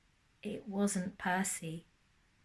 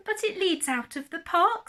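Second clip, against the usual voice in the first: higher, louder anxious hedgehog; a small hedgehog protesting; worried hedgehog; 9 semitones higher, 5 dB louder than usual. Acted. Hedgehog objecting to the fox, higher and louder. Hedgehog voiced in read story.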